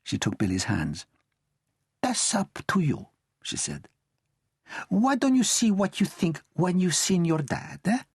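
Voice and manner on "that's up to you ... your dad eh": put-on high tender old lady; gentle grandmother. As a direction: reassure the boy.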